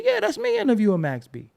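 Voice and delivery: retold excitement; excited confirmation. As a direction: say it up high, proud to claim it. Retells confirming it is him.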